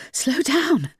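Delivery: panting, out of breath plea. A narrator's reading. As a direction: gasp it out out of breath. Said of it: "panted Joanne".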